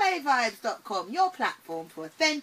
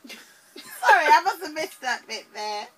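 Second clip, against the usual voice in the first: laughing; 4 semitones higher, 8 dB louder than usual. Real, not acted. Laughing after missing cue.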